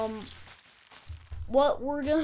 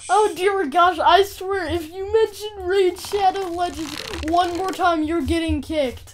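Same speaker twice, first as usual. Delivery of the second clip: loud, higher exasperated warning; half-joking fed-up threat; 6 semitones higher, 6 dB louder than usual. Real, not acted. Fed up with sponsor mention.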